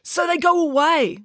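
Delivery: high, pleading tone; anxious high plea; pleaded. A narrator's reading. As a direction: beg her to go along with it. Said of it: "pleaded Joe".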